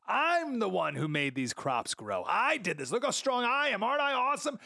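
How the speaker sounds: high, rising mock brag; boastful king. Preacher voicing the proud king to the congregation, mock bragging. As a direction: puff up like a vain king showing off.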